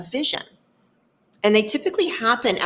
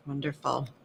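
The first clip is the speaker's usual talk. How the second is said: ordinary delivery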